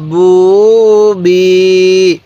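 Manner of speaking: very slow, each vowel stretched long; drawn-out syllables. Shown as a demonstration. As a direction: stretch সা সু সি for a learner. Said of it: "এরকমভাবে শিখব।". (টেনে টেনে ধীরে উচ্চারণ)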